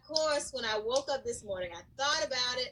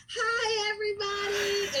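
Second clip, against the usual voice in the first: put-on high, singsong hi to children; cheery class greeting; 7 semitones higher than usual. Shown as a demonstration. Teacher greets her young class online, high and cheery. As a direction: greet the little ones brightly. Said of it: "my hi is".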